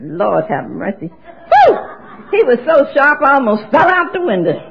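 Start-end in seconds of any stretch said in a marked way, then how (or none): 1.1-1.8 admiring whew. Real, not acted. Admiring a sharp-dressed man.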